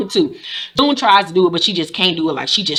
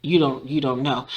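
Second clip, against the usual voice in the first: soft, low mock reply; quiet retort; 6 semitones lower, 9 dB quieter than usual. Lawyer to Rita, low quiet mock reply. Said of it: "So he was like".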